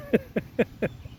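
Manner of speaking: soft chuckle; amused laugh. Real, not acted. Reader amused by own squeaky little voice, soft laugh. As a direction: laugh quietly at your own voice.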